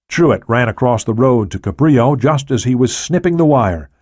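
plain reading voice

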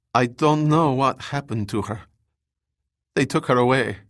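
lightly voiced wounded survivor; injured woman. A narrator's reading. Wounded survivor telling rescuers of an abduction, lightly voiced. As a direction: play the hurt scientist with a slight change of voice.

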